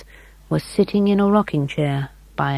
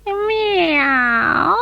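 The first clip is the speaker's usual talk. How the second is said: high drawn-out mew; cat meow; 13 semitones higher than usual. A narrator's reading. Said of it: "said the cat again".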